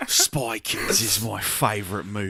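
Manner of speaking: a touch of British accent; mild British accent. Shown as a demonstration. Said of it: "do a British accent".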